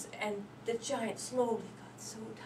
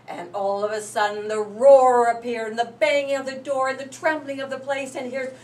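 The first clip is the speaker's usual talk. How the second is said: much louder, slowing on the roar; dramatic loud narration; 2 semitones higher, 11 dB louder than usual. A narrator's reading. Narrator announcing the giant's arrival, loud and dramatic. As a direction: boom it out.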